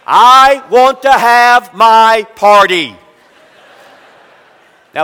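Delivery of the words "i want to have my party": loud, slow whiny wail; boy whining for his party, loud mock wail; child wailing. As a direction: drag it out like a crying five-year-old. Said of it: "began to scream and cry".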